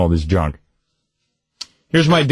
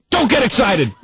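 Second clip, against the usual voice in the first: sudden high-pitched scolding; parent snapping; 10 semitones higher than usual. Retold or imitated. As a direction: imitate a parent abruptly squashing a kid's excitement. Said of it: "mixed messages from my parents".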